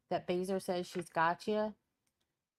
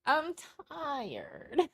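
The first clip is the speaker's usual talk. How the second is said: pitched-up, whiny "I'm tired"; mock tired whine; 6 semitones higher than usual. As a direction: tease by copying her tired voice. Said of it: "how tired she is in that voice".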